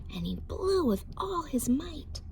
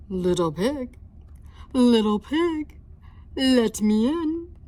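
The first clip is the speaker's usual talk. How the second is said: loud, growly-free big bad wolf call; wolf at the door, loud; 2 semitones higher, 7 dB louder than usual. Wolf voiced in read story.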